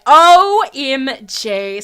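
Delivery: loud, slow, drawn-out letters; astonished exclamation. Pretend. Player amazed she's okay about it, astonished. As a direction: belt out each letter in mock amazement.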